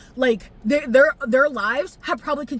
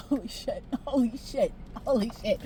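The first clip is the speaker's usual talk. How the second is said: stunned murmur; 9 dB quieter than usual. Real, not acted. Vlogger thinking of Kinger's wife, stunned soft murmur. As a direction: breathe it out, overwhelmed.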